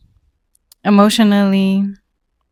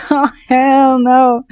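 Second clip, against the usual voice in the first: outraged; 4 semitones higher, slower than usual. Retold or imitated. Repeats reaction, louder.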